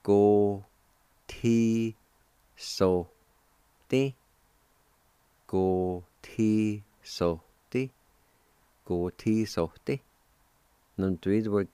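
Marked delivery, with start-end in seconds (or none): 0.0-4.2 "Go ti so te" in separate, drawn-out syllables. Shown as a demonstration. Teacher shows how kindle is pronounced, syllable by syllable. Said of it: "It's pronounced like this". 5.5-7.9 "Go ti so te" slowly, each syllable held; slow sounding-out. Shown as a demonstration. Teacher models the word kindle for learners, slowly syllable by syllable. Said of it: "It's pronounced like this".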